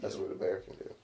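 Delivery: soft breathy whisper of the same line; whispered echo. Pretend. Co-host echoes the bear line in a whisper. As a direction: hush it like a spooky echo.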